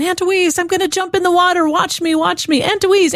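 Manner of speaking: put-on high, excited little-boy voice; toddler calling. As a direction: play the two-year-old nephew begging to be watched. Great nephew Leo calling from pool.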